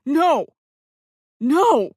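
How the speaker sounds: high, very loud no; man refusing to be dropped, loud; panicked refusal. Acted. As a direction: yell no in terror. Zane refusing to be lowered.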